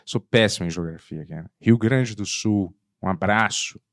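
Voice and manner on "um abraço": ordinary talk, nothing marked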